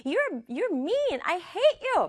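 high-pitched acted anger; mock angry outburst. Teacher voices the angry sister before slamming the door, mock angry outburst. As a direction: spit it out high and upset. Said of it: "막 지율이도 화가 나가지고".

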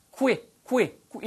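'qui' as kw, lips pushed out then back; exaggerated w glide in 'qui'; lip-rounded qu. Shown as a demonstration. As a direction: punch out 'qui' in separate repeats. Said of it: "입술이 나갔다고 들어오면서".

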